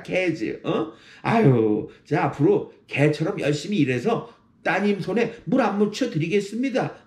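느리게 끌며 스스로 낮추는 익살; 웃으며 내 처지를 먼저 깎아내리듯; self-deprecating humor. Shown as a demonstration. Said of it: "이렇게 말을 하는 거예요". (장인어른께 스스로 낮추며 너스레 떠는 말투)

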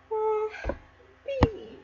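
very high, soft coo; pitying coo. Pretend. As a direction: coo sorrowfully over the poor baby. Reader pities the abandoned baby.

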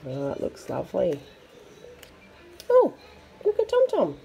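plain reading voice

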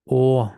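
"О" held as a long vowel. Shown as a demonstration. Teacher isolates the vowel for learners, long "О". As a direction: draw out a full "О". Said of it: "Довга у нас о".

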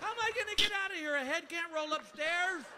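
high, loud panicked questions; hammed-up stoner panic; freaked out. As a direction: fret aloud in comic desperation. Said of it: "I pretty much freaked out".

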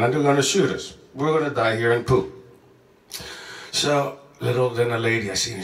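ordinary talk, nothing marked

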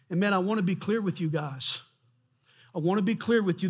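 ordinary talk

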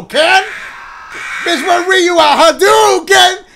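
loud, high Street Fighter call; joking loud shout of Hadouken. Real, not acted. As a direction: belt out Hadouken like the game.

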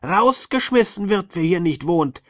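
high, loud furious yell; shouting. Acted. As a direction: bellow it like an angry little sandman. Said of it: "schrie der Sandmann sie an".

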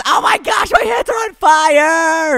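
high, loud excited yell; excited shout. Real, not acted. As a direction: shout it out in amazement.

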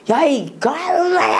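high, drawn-out angry yell; hammed-up boss's cursing outburst. Boss cursing out the employee who refused to go, high angry yell. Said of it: "욕을 막 한 거야".

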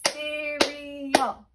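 "Cereal" stretched into three separate beats; syllable by syllable. Shown as a demonstration. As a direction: chant "Cereal" evenly, three distinct syllables. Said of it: "That's three syllables".

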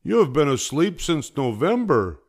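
high, loud disbelief; exclaimed protest. A narrator's reading. Frog exasperated at sleepy Toad.

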